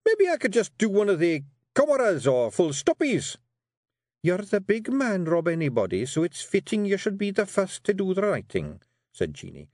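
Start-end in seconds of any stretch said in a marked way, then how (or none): 0.1-3.4 high, louder Scots Feegle voice; a wee Scots warrior wriggling out of writing; Rob pleading. Acted. Rob's voice. 4.2-8.8 high Scots wifely voice; a firm wee wife steering her man; Jeanie coaxing. Acted.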